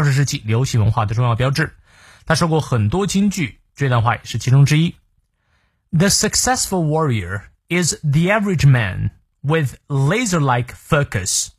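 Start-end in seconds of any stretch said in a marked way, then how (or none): 5.9-11.5 careful slow demo read; slow model reading. Shown as a demonstration. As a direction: read slowly and clearly for learners. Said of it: "model reading of the quote".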